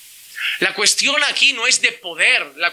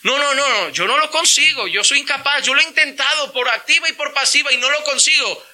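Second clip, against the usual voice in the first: whiny excuse; 6 semitones higher than usual. Retold or imitated. Mocking the excuse of a believer.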